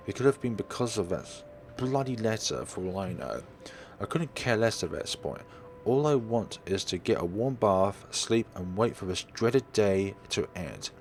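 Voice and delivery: usual speaking voice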